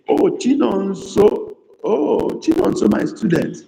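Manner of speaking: high, sing-song joyful cry of recognition; light up at seeing a favourite student; delighted recognition. Real, not acted. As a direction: pitch soaring with fond surprise. Teacher realizes who the student is.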